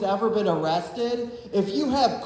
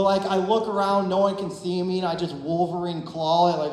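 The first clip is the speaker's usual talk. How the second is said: ordinary talk, nothing marked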